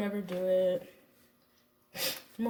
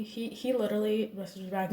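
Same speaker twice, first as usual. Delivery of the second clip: normal talking voice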